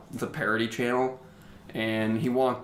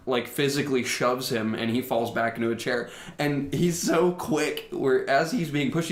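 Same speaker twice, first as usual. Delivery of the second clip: ordinary delivery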